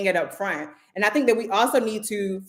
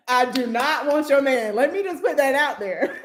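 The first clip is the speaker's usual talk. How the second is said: "I do not want your man" pitched high and emphatic, laughter behind it; joking emphatic disclaimer; 6 semitones higher than usual. Real, not acted. Telling women she's no threat, joking emphatic disclaimer. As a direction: insist on "do not" in a high, amused voice.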